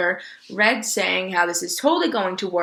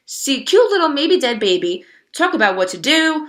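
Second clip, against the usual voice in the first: excited; 6 semitones higher, 7 dB louder than usual. A narrator's reading. Bongo explaining the plan excitedly.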